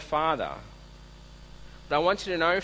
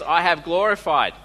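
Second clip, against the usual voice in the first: booming heavenly voice; 3 semitones higher, 6 dB louder than usual. Retold or imitated. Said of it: "And the voice says".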